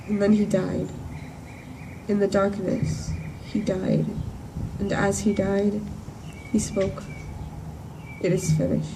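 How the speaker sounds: soft, solemn account of a death; hushed grief. Acted. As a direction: let the voice sink, with long pauses. Recounts witnessing the death of Jesus.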